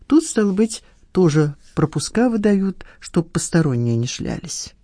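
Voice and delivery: ordinary delivery, nothing marked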